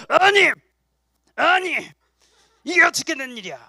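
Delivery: high, indignant outburst in short bursts; acted anger. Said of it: "화내는 것 같아요". (화내며 따지는 연기)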